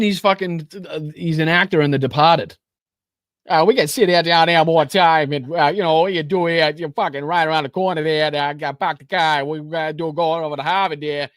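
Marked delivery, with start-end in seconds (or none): 3.5-11.3 hammed-up Boston accent, a bit louder; overdone Boston tough-guy accent; mock Boston accent. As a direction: parody a fake Boston accent. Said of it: "yanked that Boston accent".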